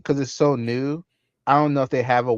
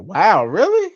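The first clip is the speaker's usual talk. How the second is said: mock surprise; 10 semitones higher, 7 dB louder, slower than usual. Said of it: "I'm like".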